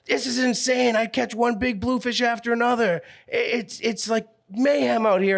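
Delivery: high, worked-up mimic of a guy on the phone; excited fisherman. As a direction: play the buddy losing his mind over the bite.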